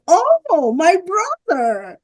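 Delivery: high, drawn-out retold greeting; acted high-pitched cry of recognition; acted delighted recognition. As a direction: stretch it out like hailing a long-lost brother. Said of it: "I'm like".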